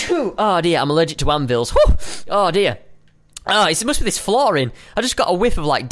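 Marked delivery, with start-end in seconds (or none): none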